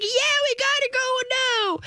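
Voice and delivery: giddy, stretched, high-pitched pet voice; excited dog. Acted. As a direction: squeal as an eager puppy. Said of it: "the dog is like".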